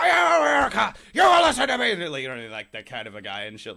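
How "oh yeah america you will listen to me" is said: over-the-top high, booming shout; Kelsey Grammer. Retold or imitated. Podcaster imitating a movie character for friends, over-the-top shouting. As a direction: bellow it like a ridiculous blowhard. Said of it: "character's, is, like, so over the top and, like, ridiculous".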